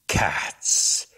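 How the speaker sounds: breathy hushed whisper. A narrator's reading. Storyteller whispering to listeners about lurking cats. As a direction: drop to a secret whisper.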